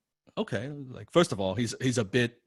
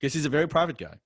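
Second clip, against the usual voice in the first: ordinary delivery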